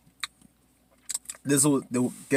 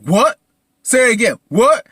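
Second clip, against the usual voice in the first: loud, high-pitched shocked questions; incredulous outburst; 9 semitones higher, 11 dB louder than usual. Acted. Jeff hearing his wife's regret, acted incredulous outburst. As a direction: fire it back in loud disbelief.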